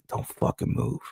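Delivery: whispered threat; menacing whisper. Acted. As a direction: hiss it low and close. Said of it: "The henchmen are all like".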